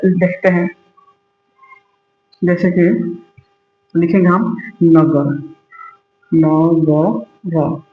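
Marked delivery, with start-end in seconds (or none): 6.3-7.8 नगर stretched out slowly, syllable by syllable; slowly sounded out. Shown as a demonstration. Teacher to students learning spelling, sounding out नगर slowly. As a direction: draw out नगर so students hear each sound. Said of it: "देख पा रहे हैं".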